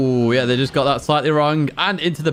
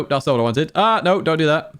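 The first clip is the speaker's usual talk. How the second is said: usual speaking voice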